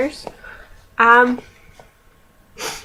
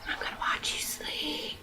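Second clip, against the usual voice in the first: breathy stalker whisper, no voice at all; a mock-sinister whisper; creepy whisper; 16 dB quieter than usual. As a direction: hush it like a creepy secret. Creepy Twilight line whispered.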